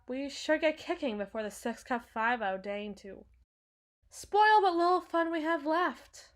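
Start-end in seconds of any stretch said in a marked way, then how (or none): 4.1-6.3 raised, louder sardonic complaint; theatrical grumble, high and loud; wry exasperation. Acted. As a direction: lift the voice high and push it out wryly. Wry urging to leave before police.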